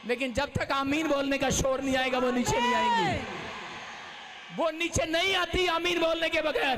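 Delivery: high, loud preacher's proclamation; loud high revival preaching; full-force preaching. Acted. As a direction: proclaim it over the crowd, shouting.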